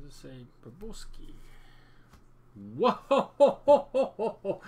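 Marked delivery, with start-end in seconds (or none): 2.8-4.6 loud high whoa with a laugh; surprised shout. Real, not acted. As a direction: burst out in surprise. Surprise at card pulled.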